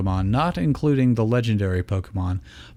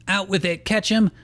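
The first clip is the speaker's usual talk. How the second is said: higher, sharp demand; snapping; 9 semitones higher than usual. Acted. Iris confronting Ash at the door, snapping. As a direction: bite off the words at him. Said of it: "Iris snapped".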